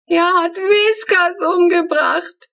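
high, loud, slow drawn-out scream; screaming in panic about the cat; panicked scream. A narrator's reading. Little girl fears her cat was shot, screaming in panic. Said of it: "schreit Flo".

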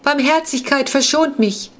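high whining plea for mercy; begging vampire. A narrator's reading. Vampire Razvan begging Ferdinand for mercy, high and whining. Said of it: "verlegte sich Razvan sofort wieder aufs Flehen".

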